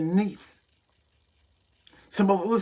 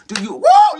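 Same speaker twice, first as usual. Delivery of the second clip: excited whoa; 7 dB louder than usual. Real, not acted. Preacher exclaims mid-sermon.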